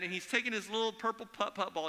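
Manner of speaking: ordinary talk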